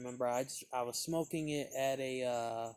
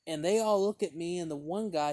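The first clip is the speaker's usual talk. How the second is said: ordinary talk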